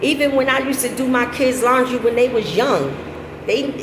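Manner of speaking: normal talking voice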